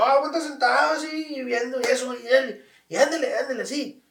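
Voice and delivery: lightly voiced dad calling out; mock dad cheering. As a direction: egg him on from the chair.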